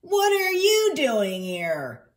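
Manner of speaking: high, loud, drawn-out astonishment; surprised question. Acted. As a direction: exclaim it slowly in disbelief. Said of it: "Ned asked in surprise".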